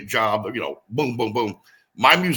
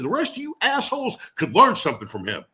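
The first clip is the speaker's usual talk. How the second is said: a wrestler voicing Larry's backstage praise, higher and proud; imitating the promoter; 9 semitones higher than usual. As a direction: voice the old promoter handing out compliments. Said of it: "He said".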